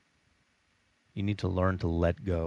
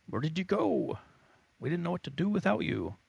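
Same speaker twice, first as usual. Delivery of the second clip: higher, hurried mock fretting; mock worried friends; 10 semitones higher than usual. Friends asking where you went, lightly hammed-up worry. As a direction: play friends who missed you.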